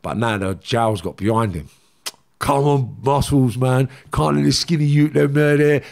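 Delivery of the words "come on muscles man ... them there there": louder, slightly higher re-enacted heckling; imitating a goading prison crowd; jeering onlookers. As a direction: egg the big man on. Jail crowd egging him on.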